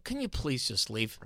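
soft, slow, overly polite ask; mock polite plea. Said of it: "But if you go".

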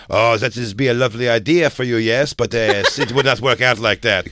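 cheerful and unbothered, in fake French; mock French host. [name] imitating the host's carefree refusal, mock French accent. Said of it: "Craig goes".

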